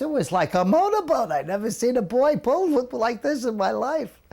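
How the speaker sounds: put-on an old swimmer raving about his rescuer, high and loud; amazed old man. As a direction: play the old man who can't believe the swim.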